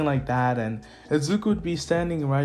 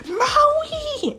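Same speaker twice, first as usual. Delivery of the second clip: high anguished cry of his name. Retold or imitated. Mother Inko crying out to Maui, high anguished call. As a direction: call him out much higher, crying. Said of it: "It's like".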